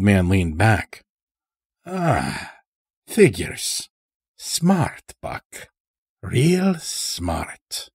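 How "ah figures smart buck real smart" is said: put-on a higher, odd country voice for the old stranger; sly old-timer with an unplaceable accent; Morris's strange accent. A narrator's reading. Chained old stranger talking to the lodge master, in an odd accent. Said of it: "spoke in such a strange way".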